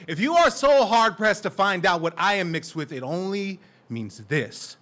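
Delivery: loud, charged spoken-word delivery; slam poetry. Acted. Poet confronting the crowd about race, loud spoken word. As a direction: drive each question at the audience.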